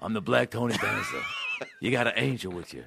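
low mock actor voice; low, softened celebrity impression; Danny Glover impression. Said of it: "your impressions of Danny Glover and Tony Danza".

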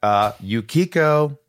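high, loud, slow name call; stretched-out cheerful callout; drawn-out call. Real, not acted. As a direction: sing out the name like a host. Calling out patron name.

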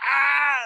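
high loud yelp of pain; fake pain yelp. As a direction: yelp like something hurts. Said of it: "I was like".

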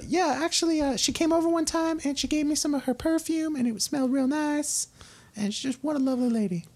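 high, folksy farmer voice; farm parent. Acted. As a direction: gush about the neighbour. Farmer voice in role-play.

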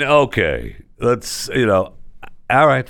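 usual speaking voice

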